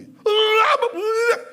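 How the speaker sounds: loud high cry for help; trapped screaming. Acted. Trapped man yelling again unheard. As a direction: yell out from below.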